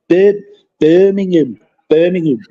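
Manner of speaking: put-on "Birmingham" said in a light Brummie accent, as the locals say it. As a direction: mimic how Birmingham people say "Birmingham". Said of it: "as they say".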